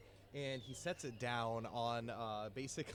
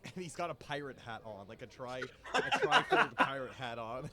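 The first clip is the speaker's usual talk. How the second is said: ordinary talk, nothing marked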